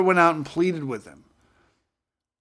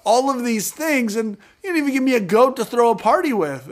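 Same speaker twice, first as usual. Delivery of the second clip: aggrieved believer; 8 semitones higher than usual. Retold or imitated. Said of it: "And we're like".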